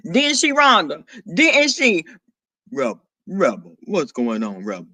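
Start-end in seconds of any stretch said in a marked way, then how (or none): 2.7-4.9 very low, softened deep register. Acted. Greeting Rebel in the chat, soft deep voice. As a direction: say hello softly from the bottom of your range. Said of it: "What in the deep voice".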